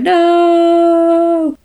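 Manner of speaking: high, loud, wailing no; anguished cry. Retold or imitated. Voicing Needy as Chip dies, a high loud cry. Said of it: "she's like".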